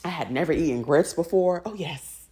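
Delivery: normal talking voice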